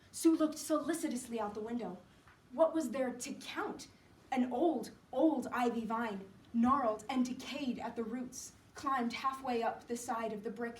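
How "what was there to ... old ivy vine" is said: ordinary delivery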